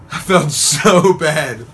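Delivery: slow, laughing confession; real laughter. Real, not acted. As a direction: giggle through the guilt. Laughing at own lie.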